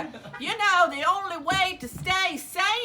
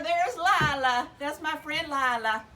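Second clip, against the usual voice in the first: usual speaking voice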